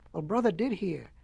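ordinary delivery, nothing marked

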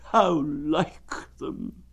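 very slow, high, drawn-out mournful donkey; gloomy Eeyore. Acted. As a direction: drag it out like a sad old donkey. Eeyore after silence.

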